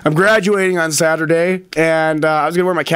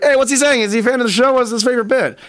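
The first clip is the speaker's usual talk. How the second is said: higher, eager, fishing for praise from a fan; eager questions; 7 semitones higher, faster than usual. Retold or imitated. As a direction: ask like a host hoping the caller loves the show. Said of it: "And I'm like".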